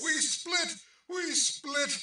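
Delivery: very high, frantic scream; panicked cry. Acted. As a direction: shriek as the hull cracks. Cry as the ship breaks.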